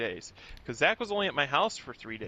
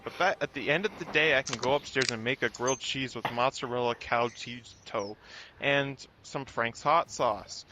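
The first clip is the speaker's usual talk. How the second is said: ordinary talk, nothing marked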